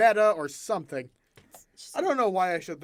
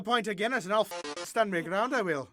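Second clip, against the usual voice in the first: quick, higher Irish brogue; Irish accent; 5 semitones higher than usual. Acted. Showing off an Irish accent to a friend. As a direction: rattle it off in hammed-up Irish. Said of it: "jump into an Irish accent now".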